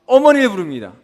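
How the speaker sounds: ordinary talk, nothing marked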